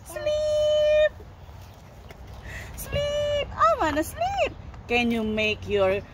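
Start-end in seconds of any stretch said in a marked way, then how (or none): 0.0-4.5 very high little cow; high-pitched puppet voice; toy cow voice. Pretend. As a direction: speak for a tiny sleepy cow, pitched way up. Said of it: "And he wants to".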